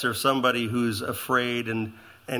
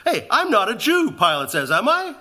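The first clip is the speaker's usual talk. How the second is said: high, louder sarcastic question; indignant retort; 8 semitones higher, 5 dB louder than usual. Retold or imitated. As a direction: toss it back with scorn. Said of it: "He said".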